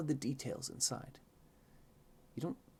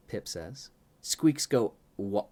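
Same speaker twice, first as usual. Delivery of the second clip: plain reading voice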